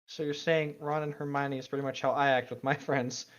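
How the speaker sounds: ordinary talk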